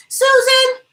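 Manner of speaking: high, loud holler of a name; calling out. Acted. Guest calling her friend, excited holler. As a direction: shout through to the next room.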